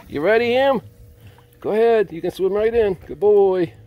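excited dog-mom praise; high cheering pet voice. Real, not acted. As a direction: cheer Ham into the water, way up high.

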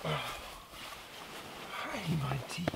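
soft whispered hello. Real, not acted. Owner whispering hi to sleeping dog Monty. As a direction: whisper so the dog stays calm.

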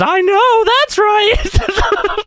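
very high puppet squeal; Elmo. Acted. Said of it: "Elmo says".

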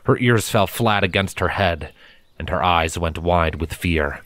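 ordinary delivery, nothing marked

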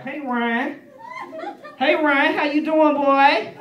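high, loud secretary greeting; imitated secretary. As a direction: holler a hello as dad's sassy secretary. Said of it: "Why are you talking like that?".

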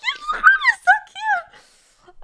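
very high, drawn-out tearful squeak; teary squeal. Retold or imitated. Streamer gushing over a chatter's profile picture, teary squeal. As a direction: squeal it out, overcome by cuteness. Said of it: "then I get teary-eyed".

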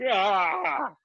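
high, drawn-out "aah" of exasperation; exasperated groan. Retold or imitated. Dad groans at interruption.

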